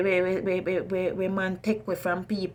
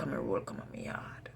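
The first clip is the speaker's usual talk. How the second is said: whispering; 6 semitones lower, 10 dB quieter than usual. Real, not acted. Quiet aside about his routine.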